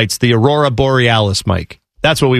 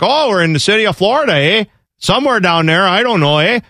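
higher-pitched mock Canadian accent; Canadian hockey accent; 6 semitones higher than usual. Canadian flying in unsure where Florida is, Canadian accent. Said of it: "I don't know why I'm doing this Canadian accent".

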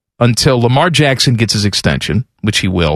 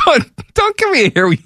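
laughing protest; 12 semitones higher than usual. Real, not acted.

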